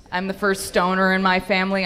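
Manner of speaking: ordinary talk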